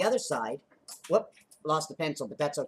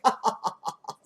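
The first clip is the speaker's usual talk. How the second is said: high mock laughter; hammed-up, repeated "ha" laugh; imitated laughing guy; 4 semitones higher, much faster than usual. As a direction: act out a cartoonish ha-ha laugh. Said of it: "I put a guy like this going".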